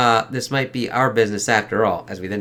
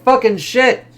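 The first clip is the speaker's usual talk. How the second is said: real startled outburst; 12 semitones higher, 8 dB louder, slower than usual. Real, not acted. Reacting to the show.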